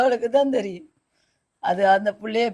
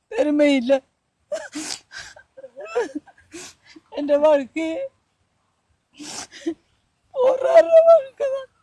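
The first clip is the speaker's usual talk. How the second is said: real tears breaking through the words; crying; 10 semitones higher than usual. Real, not acted. Mother telling the visitor of her hard life, crying. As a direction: voice breaking into sobs, pitch climbing. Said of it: "அழாதீங்கம்மா".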